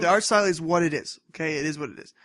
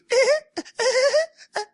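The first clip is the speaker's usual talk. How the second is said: shrill little imitated giggle, much higher and louder; mock high giggle; 7 dB louder than usual. Mimicking the heroine giggling at everything, high and loud. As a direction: giggle like a silly anime girl. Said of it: "she goes".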